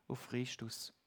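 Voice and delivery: hushed whisper of the name. Real, not acted. Preacher whispers the name to the congregation, hushed. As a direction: breathe it out like a secret. Dramatic pause, pointing to Christ.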